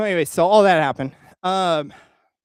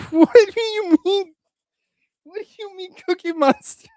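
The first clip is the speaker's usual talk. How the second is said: laughing question; 13 semitones higher, 5 dB louder than usual. Real, not acted. Laughing at chat's request.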